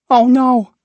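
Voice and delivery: high, loud "oh no"; worried gasp. Acted. Rumpel fears the boy's remark, high loud worry.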